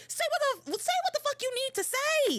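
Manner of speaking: high-pitched mock yelling at the screen; retold impatience, pitch shooting up; hammed-up, fed-up demand; exasperated outburst. Retold yelling at slow characters.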